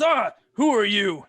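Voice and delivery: high, loud barked challenge; loud soldier's "who are you"; gruff soldier challenge. Acted. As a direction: stop the stranger cold.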